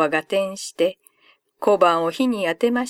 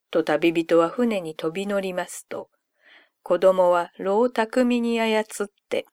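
usual speaking voice

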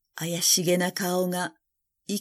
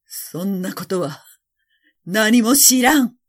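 emperor in denial; 4 dB louder than usual. A narrator's reading. Emperor answering the accusing faces, defiant outburst. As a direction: start held back, then burst out in refusal. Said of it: "皇帝は言いました".

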